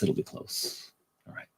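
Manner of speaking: voiceless, very soft aside; a soft whispered afterthought. Real, not acted. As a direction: breathe it out almost silently. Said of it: "but it'll be close".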